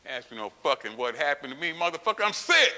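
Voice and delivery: indignant, climbing to a high, loud yell; angry retort building to shout. Retold or imitated. Sick patient scolding the doctor, anger building to a shout. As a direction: build steadily and explode on the last word.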